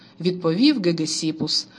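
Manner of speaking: usual speaking voice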